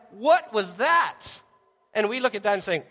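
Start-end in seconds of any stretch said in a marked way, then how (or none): 0.1-1.2 slow, loud, stunned question; alarmed disbelief. Acted. Terrified disciples react to the stilled storm, astonished. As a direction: stretch it out in acted astonishment.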